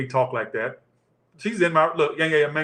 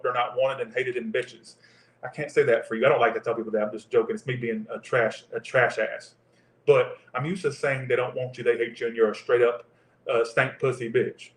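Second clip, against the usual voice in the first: ordinary talk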